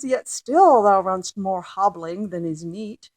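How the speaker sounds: loud, frustrated mock complaint; a hint of comic frustration at the clumsy child; exasperated parent. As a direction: read it as a fed-up mother. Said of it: "she's being funny and says".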